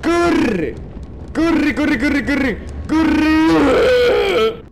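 high, loud repeated yells; panicked shout. Real, not acted. Gamer urging the character to run, panicked shouting. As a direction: yell it urgently, stretching the final one. Urging escape from the chasing monster.

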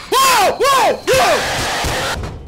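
high, loud panicked whoas; startled yelling. Real, not acted. Player surprised by the animatronic, panicked yelling. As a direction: yell in repeated bursts of alarm.

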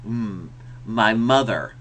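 slow, humming "Mm, my mother"; M on the lips. Shown as a demonstration. Teacher showing where the lips close for M. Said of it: "pronounce an M on their teeth".

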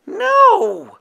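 sharp high firm refusal. A narrator's reading. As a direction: stop him firmly. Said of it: "replied Howler firmly".

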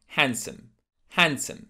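HANDSOME as "HAN-sum", silent D; correct handsome. Shown as a demonstration. Teacher modelling handsome for learners, D left silent. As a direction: drop the D in "handsome", weak ending. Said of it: "There is no de".

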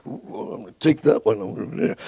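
hushed, covetous aside; soft scheming murmur; sneaky aside. Acted. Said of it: "and you're like".

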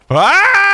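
sudden high shout of alarm; startled yell. Real, not acted. Gamer yelling as the basilisk charges at him. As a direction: yelp as the beast charges.

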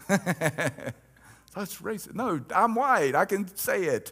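high, laughing, playful protest; laughing joke. Acted. Pastor joking with the congregation, laughing aside. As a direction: toss it off with a laugh.

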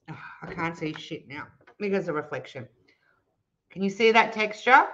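ordinary talk

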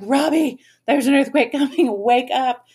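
lightly acted urgent warning; urgent retold wake-up call. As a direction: rouse a sleeping brother, half laughing. Waking brother about an earthquake.

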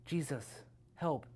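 meek, hushed little request; timid plea. Acted. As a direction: barely dare to ask for help. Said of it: "so timidly, he was like".

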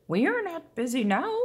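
high, odd little frog voice. Acted. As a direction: play a cheerful frog saying yes. Frogs answer the rooster.

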